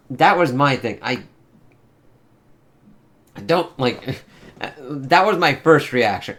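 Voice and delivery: normal talking voice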